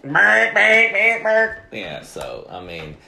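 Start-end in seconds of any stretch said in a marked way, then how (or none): 0.0-1.6 high, loud mock barking; imitated barking. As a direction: bark it out like a dog, much higher and louder. Mocking friends honking outside.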